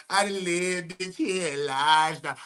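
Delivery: a light hint of [name]'s light voice, lightly imitated.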